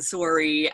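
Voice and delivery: "sorry" said as SORE-ee; Canadian pronunciation. Retold or imitated. Wife mimics Canadian husband, "sorry" said his way. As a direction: imitate a Canadian "sorry" with a rounded O. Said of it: "he's Canadian and".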